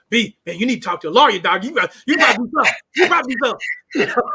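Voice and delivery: loud, fast, pressing urging; urgent retold advice. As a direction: push him hard to act, almost shouting. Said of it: "I was telling in, in the group".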